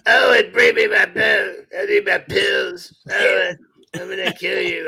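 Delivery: put-on high, loud screeching old mother; hammed-up old mother demanding her pills; imitating Mama from the movie. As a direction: nag and threaten in a shrill movie-mother voice. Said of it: "that was the throw mama from the train reference".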